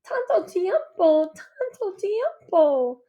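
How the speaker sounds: high, slow Spanish lament; a mother sighing over the long wait; slow, high mournful repeat. A narrator's reading.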